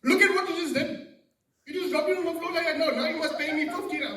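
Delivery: high, loud acted outrage; hammed-up furious Pakistani salesman; angry seller. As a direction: accuse and demand payment in a vendor's voice. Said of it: "he got angry at me".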